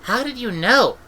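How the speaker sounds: put-on high suspicious child's voice; suspicious girl. Young Esk asking Granny warily, in a high child's voice. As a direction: squint at her and ask high, wary. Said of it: "opened a suspicious eye".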